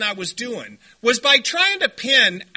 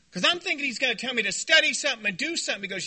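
ordinary delivery

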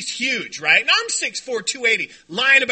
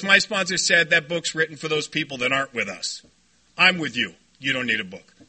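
put-on much lower, unhurried old-timer voice; low-key sponsor; 8 semitones lower, 4 dB quieter than usual. Sponsor passes on his own sponsor's view, low and matter-of-fact. Plays sponsor's unbothered reply.

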